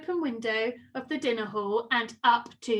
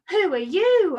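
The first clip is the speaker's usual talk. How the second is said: startled; 5 semitones higher, 7 dB louder than usual. A narrator's reading. Startled by an enormous spider.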